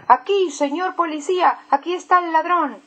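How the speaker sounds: shouting. Acted. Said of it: "pero Ernesto grita".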